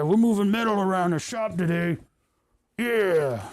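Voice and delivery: mock manly bravado; macho he-man. Macho heavy metal bit.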